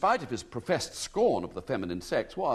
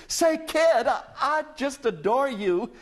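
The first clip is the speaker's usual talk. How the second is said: much higher, louder, laughing joy; delighted; 12 semitones higher, 5 dB louder than usual. A narrator's reading. American lady thrilled at William, gushing with laughing joy. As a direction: gush with sudden delight. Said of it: "The lady leapt in her seat".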